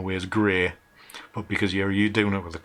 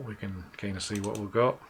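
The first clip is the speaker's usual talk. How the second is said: ordinary talk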